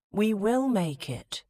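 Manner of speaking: insisting. Acted. Man reassuring her on the coach, firm insistence. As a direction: lean in and insist. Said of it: "he insisted".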